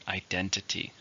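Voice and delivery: "Identity" with the weight on DEN; stress on second syllable. Shown as a demonstration. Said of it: "stress in the second".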